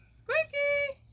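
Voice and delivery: very high, loud squeal; calling a pet. Real, not acted. Owner calling Squeaky to come running, squealed calling. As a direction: squeal the pet's name out loud.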